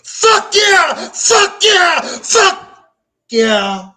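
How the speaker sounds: jumping up yelling in triumph; triumphant yell. Retold or imitated. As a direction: roar it out in victory. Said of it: "as loud as I could yell it".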